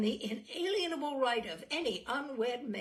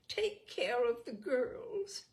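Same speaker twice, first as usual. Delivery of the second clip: high, thin voice; 3 semitones higher, 3 dB louder, slower than usual. Acted. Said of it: "in her high, thin voice".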